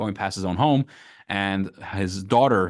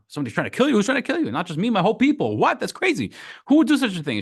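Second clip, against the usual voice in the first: shocked king; 10 semitones higher, faster than usual. Acted. King shocked by Esther's news.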